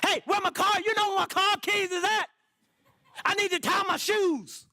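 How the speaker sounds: hammed-up yelling for a sandwich; mock shouting. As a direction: holler it like a silly demand. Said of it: "I don't walk around and say".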